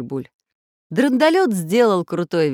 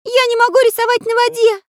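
very high, loud, fast little voice; exasperated character; 8 dB louder, faster than usual. A narrator's reading. Artist in the water protests to the boy, exasperated. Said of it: "вздохнул Карандаш".

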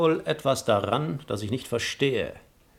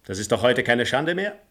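ordinary talk, nothing marked

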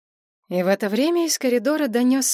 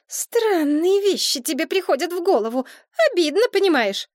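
put-on hurt young woman; 9 semitones higher than usual. A narrator's reading. Maria hurt.

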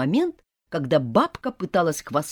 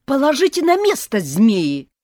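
loud, high furious yell; exploding outburst; 9 semitones higher, 9 dB louder than usual. A narrator's reading. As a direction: burst out at them. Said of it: "взорвалась я".

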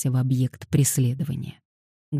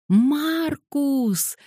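higher, happy little squeak; joyful squeak; 12 semitones higher than usual. Acted. Little girl squeaking happily as Marcus turns round. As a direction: squeal with delight that he turned. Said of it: "радостно пискнула".

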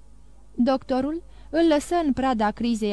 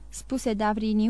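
ordinary delivery, nothing marked

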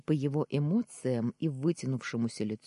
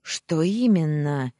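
loud, drawn-out grunted question; evasive grunt; 3 semitones higher, 9 dB louder than usual. A narrator's reading. Uncle dodging his nephew's question, evasive grunt. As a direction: stall with a slow, grunted question. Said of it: "крякнул он".

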